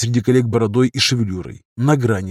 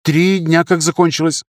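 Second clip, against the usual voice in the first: higher-pitched junior doctor reporting; young surgeon; 6 semitones higher than usual. Acted. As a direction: report in a raised, youthful pitch. (молодой хирург, высоким голосом)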